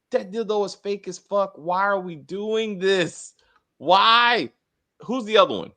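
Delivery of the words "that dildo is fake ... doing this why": retold exasperation, drawn out and higher; exasperated. Host reenacting their reaction to the scene, exasperated.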